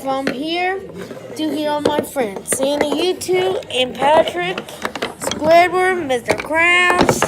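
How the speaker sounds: loud roll call to all the toys. Pretend. Leader calling every toy to join, loud. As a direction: shout each name to the crowd.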